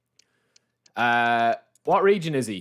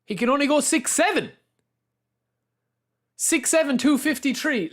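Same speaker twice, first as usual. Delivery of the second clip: high, incredulous complaint about the limit; disbelief; 10 semitones higher than usual. Real, not acted. Streamer tells chat the height cap, pitched high in disbelief. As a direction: sound shocked at how short he is.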